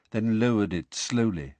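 usual speaking voice

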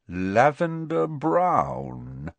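very slow, high, drawn-out name; teasing reveal. A narrator's reading. George revealing Ron's girlfriend's name, teasing. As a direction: draw the name out to tease.

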